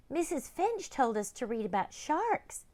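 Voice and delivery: put-on high explaining girl; high girl's voice; young girl. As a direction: lay it out like a good student.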